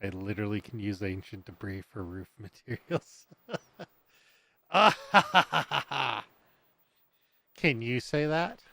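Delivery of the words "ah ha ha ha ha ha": loud "ha-ha" laugh starting high and falling. Real, not acted. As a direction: burst out laughing, then tail off. Gloating over lavish material use.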